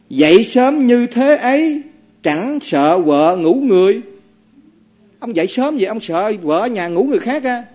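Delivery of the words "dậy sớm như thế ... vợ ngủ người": slow teasing verse, a bit higher; reciting verse. Acted. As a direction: drop the barb slowly. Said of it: "thiền sư mới nói như thế này". (đọc kệ chậm, trêu chọc)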